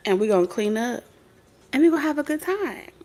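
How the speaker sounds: normal talking voice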